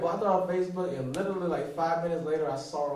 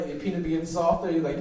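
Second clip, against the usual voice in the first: ordinary delivery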